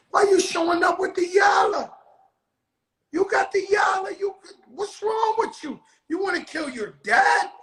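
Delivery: high, loud mock outburst at a sneezing son; retold yelling at son. Father yelling at his sneezing son in the truck, loud and high. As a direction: blow up at him, half laughing at it.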